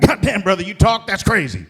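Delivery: high, loud amazed yelp; stunned shout. Retold or imitated. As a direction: react like your dog just spoke. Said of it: "I'm like".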